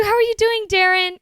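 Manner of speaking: high, bubbly hello; excited greeting. Real, not acted. Streamer welcomes a viewer by name, excited high greeting. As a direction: greet them in a high-pitched voice.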